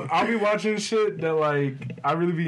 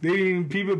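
usual speaking voice

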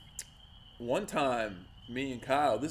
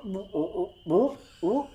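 imitated shivering brr; 6 semitones higher, slower than usual. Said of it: "Kyle's like".

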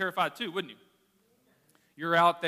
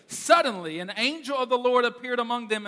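dramatic reading; 5 semitones higher, 6 dB louder than usual. Acted. Pastor reading Luke aloud, dramatic and raised. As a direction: Suddenly hit loud, then a raised reading voice.

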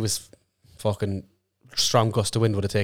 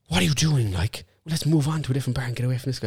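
higher, fast, pressing voice; urgent hushed plea; 4 semitones higher, faster than usual. Retold or imitated. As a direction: rush it out like you're desperate to escape. Larkin quoted plotting escape.

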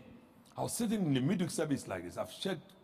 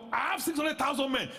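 preaching shout; 8 semitones higher than usual. Acted. Preaching Moses' doubt loudly.

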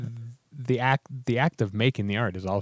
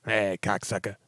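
a rough tough-guy bark, lightly done; quick comic jab in a put-on voice; Joey Diaz impression. Cohost tosses off a Joey Diaz line in a put-on tough voice. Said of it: "Joey Diaz or something".

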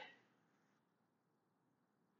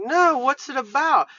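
high, louder imitation of a woman asking; imitated curious lady; 9 semitones higher, 5 dB louder than usual. Two females answer the other ladies, high curious imitation. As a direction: put on a raised lady's voice, curious.

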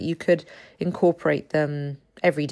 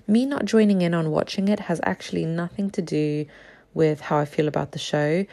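ordinary talk

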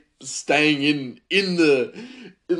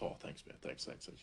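whispered thanks; 19 dB quieter than usual. Retold or imitated. Chef's whispered thanks retold.

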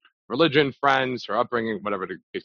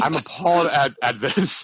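hammed-up indignation, a bit louder; mock outrage at the girl; mock offended; 4 semitones higher, 4 dB louder than usual. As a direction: play outraged over nothing. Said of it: "act very mock, mock offended".

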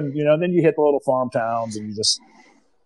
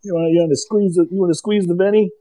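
higher-pitched New Jersey wise guy; hammed-up New Jersey come-on; put-on New Jersey accent; 6 semitones higher, 3 dB louder than usual. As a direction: play a leering New Jersey local. Continues the Jersey guy bit.

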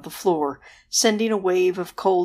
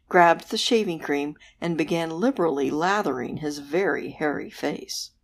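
ordinary delivery, nothing marked

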